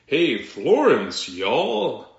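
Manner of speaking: loud, drawn-out mock Southern accent. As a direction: imitate a slow Southern drawl. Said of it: "someone talking from the South".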